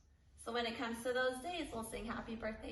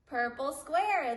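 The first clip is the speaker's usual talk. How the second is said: excited; 4 semitones higher, 8 dB louder, slower than usual. Real, not acted. Class answers the pattern.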